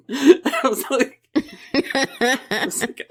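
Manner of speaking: slow, high, laughing. Real, not acted. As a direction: giggle it out slowly. Cracking up over the meme.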